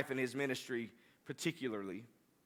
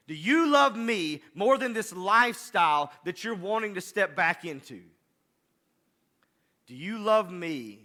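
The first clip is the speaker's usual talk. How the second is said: louder pleading, slowed on each question; a passionate plea from God, getting louder; rising preaching; 4 semitones higher, 7 dB louder than usual. Acted. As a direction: press the question home like a preacher building. Preaching builds as God's question.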